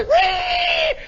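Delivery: loud, piercing imitated whine; long high wire squeal. Imitating the chute catching the wind, high squeal. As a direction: squeal like a runaway winch wire.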